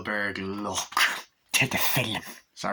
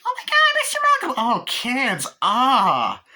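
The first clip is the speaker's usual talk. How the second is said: put-on high squealing kids' delight; loud falsetto gushing; mock excited greeting; 11 semitones higher, 6 dB louder, slower than usual. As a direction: squeal a hammed-up reunion hello. Voices excited reunion with kids.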